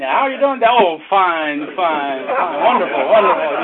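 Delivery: sweet churchgoer. Retold or imitated. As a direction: put on a sugary church smile. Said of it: "they're just all sweet".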